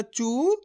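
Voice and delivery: चू drawn out, gliding upward; long vowel. Shown as a demonstration. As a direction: hold the oo of चू and let it rise. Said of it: "खींच खींच के इसका उच्चारण".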